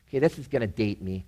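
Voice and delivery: usual speaking voice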